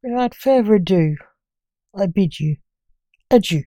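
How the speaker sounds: loud, showy host intro; podcast announcer. Acted.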